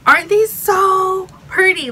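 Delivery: high, loud, slow gushing; excited gushing. Real, not acted. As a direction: swoon over the shoes, drawn out. Showing off new shoes.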